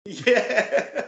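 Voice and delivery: high, loud laughing yeah; delighted, laughing out loud; laughing agreement. Real, not acted. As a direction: agree through a burst of laughter. Laughs after acting out inner voice.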